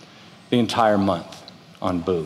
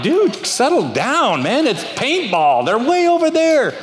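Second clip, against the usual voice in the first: exasperated calming; 13 semitones higher, 3 dB louder than usual. Retold or imitated. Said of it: "and I'm like".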